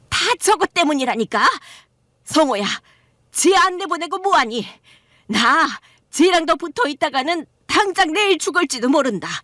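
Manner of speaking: mother-in-law blaming her son's wife for her cancer; accusing mother-in-law. Acted.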